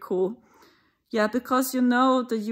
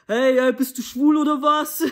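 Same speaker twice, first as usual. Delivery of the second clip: louder mocking street-thug voice, laughing through it; German gangster challenge; 4 semitones higher, 6 dB louder than usual. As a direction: taunt like a tough German teen, half laughing. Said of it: "This is how the, the Germans talk".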